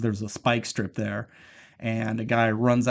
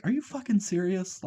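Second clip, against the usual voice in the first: high-pitched mock disbelief; incredulous; 8 semitones higher, 4 dB quieter than usual. Wife answering the cover-up plan, incredulous. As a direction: ask it high, as if appalled. Acts character's incredulous outburst.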